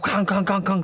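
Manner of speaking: high, punchy repeated bangs; imitated gun blasts. Retelling shots fired through the door, banging noise. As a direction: voice four gunshots in a burst.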